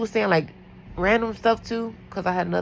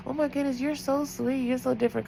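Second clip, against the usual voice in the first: gushing daughter; 7 semitones higher, 4 dB quieter than usual. Retold or imitated. Daughter's gushing praise voiced.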